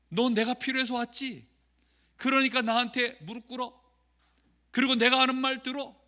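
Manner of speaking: lightly voiced arrogant shaman barking orders; mock rude shaman. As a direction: order them around like a haughty shaman, only half-acted. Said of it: "반말하더라고요".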